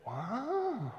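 a soft, breathy wow of awe; hushed wow. Retold or imitated. Preacher recalling the skyscraper, hushed in awe. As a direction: let the wow fall away quietly in wonder. Awe at the Empire State Building.

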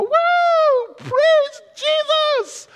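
high, loud joyful whoop; rocks shouting praise. Pretend. Preacher voicing rocks crying out to Jesus, joyful loud whoop. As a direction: cry out like ecstatic worshippers. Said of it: "The rocks themselves will go".

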